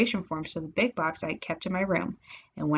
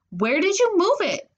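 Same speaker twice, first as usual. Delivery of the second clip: much higher, exasperated question; angry demand; 12 semitones higher, 3 dB louder than usual. A narrator's reading. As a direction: demand to know, pitch shooting up. Demanding where sister moved box.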